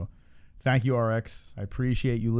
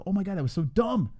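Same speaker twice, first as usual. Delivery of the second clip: high mock disbelief; 8 semitones higher than usual. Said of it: "be like".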